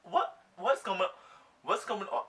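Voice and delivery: loud, high, halting whats; acted-out bewilderment; stunned confusion. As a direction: blurt it out in disbelief, with long gaps. Acting out shock in her head.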